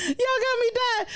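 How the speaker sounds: high, giggling words; laughing while talking. Real, not acted. Host telling chat they got him dying, laughing. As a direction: talk through the laughter.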